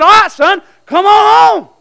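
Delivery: loud, high fatherly holler; calling someone home. Acted. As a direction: call out to a son from afar. God calling His child home.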